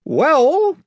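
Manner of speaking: loud, high, rising opener; chief voice. A narrator's reading. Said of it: "said the chief voice".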